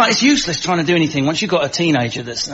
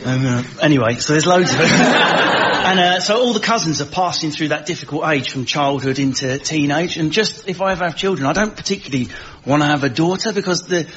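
ordinary delivery

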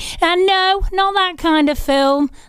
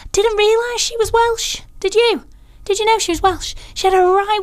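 much higher, amazed disbelief; surprised; 9 semitones higher than usual. Real, not acted. Radio host surprised to learn the singer was Welsh, high amazed questions. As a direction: ask it like you just learned something shocking.

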